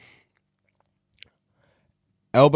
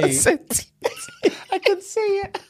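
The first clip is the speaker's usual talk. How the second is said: laughing. Real, not acted. Laughing, high squeaky voice.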